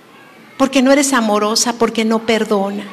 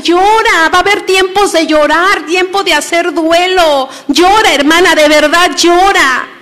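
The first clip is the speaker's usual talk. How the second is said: high, louder urging; higher and louder fervent urging, softening to levántate; 5 semitones higher, 4 dB louder than usual. Retold or imitated. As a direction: push her to cry it out. Quotes herself urging, high, louder.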